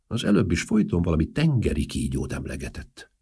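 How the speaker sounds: usual speaking voice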